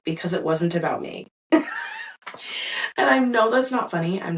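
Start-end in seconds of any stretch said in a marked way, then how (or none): 1.5-2.9 sudden high burst of real laughter. Real, not acted. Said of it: "I'm laughing because at this point".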